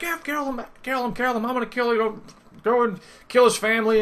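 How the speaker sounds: very high, squawking mock chant; bloodthirsty mob. Whole party wanting him killed, high-pitched mocking imitation. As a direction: imitate an over-eager party egging each other on.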